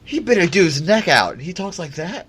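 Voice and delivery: high-pitched, excited gushing fan voice; mock gushing fan. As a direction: imitate a fan swooning over the British accent, high-pitched. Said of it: "You're like".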